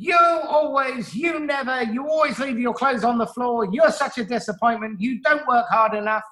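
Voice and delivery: high nagging scold, pitched well up; critical parent. Acted. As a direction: nag the child like a fed-up parent. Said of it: "the persecuting parent says".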